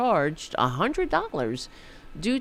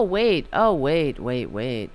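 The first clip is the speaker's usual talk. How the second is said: ordinary delivery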